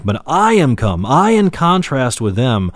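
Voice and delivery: "I" punched and set apart, both times; emphatic stress on I. Shown as a demonstration. Preacher contrasting the shepherd with the thieves, emphatic "I". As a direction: stretch and stress "I" to mark the contrast. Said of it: "this is emphatic".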